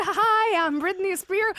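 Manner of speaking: high, put-on pop-star English. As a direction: play a hypnotized bartender who thinks they're a star. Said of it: "je deviens tout à coup [name]".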